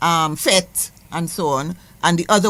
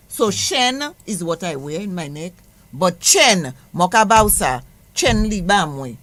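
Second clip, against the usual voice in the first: ordinary talk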